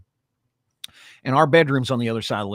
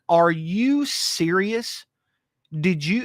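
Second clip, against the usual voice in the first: high, very slow disbelief; asking Alex, drawn out high in shock; stunned disbelief; 8 semitones higher, much slower than usual. Retold or imitated. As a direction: drag it out, astonished. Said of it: "I was like".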